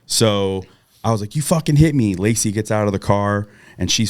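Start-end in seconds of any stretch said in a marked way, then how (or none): 1.4-2.1 higher, a bit louder outraged yell; retold angry shout. Yelling at the driver who just hit him, retold outraged shout. After being hit by car.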